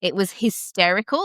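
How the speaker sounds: ordinary delivery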